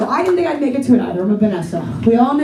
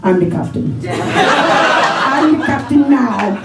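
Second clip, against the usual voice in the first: movie pirate line; slower than usual. Retold or imitated. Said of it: "What's up with the Captain's hat?".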